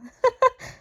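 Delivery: high, loud burst of laughter; real laugh. Real, not acted. Storyteller cracking up at her own telling, laughing.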